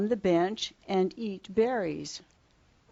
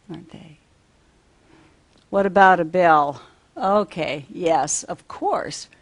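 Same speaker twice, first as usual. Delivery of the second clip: usual speaking voice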